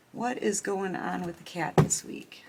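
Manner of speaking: whispered question to the cards. Real, not acted. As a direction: hush it, asking the deck.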